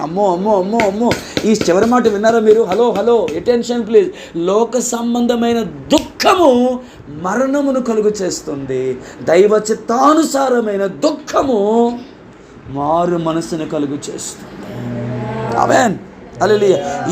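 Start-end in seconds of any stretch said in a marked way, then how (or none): 4.4-15.6 slow, weighty restating of the verse; solemn emphasis. Acted. Pastor drives the verse home to the listeners, slow weighty emphasis. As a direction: lean hard on every word.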